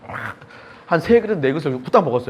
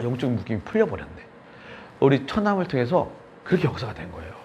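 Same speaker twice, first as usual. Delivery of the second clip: ordinary talk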